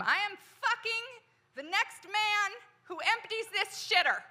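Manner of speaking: high-pitched furious yell at her husband. Retold or imitated. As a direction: shriek it out, fed up and done. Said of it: "I yelled at him".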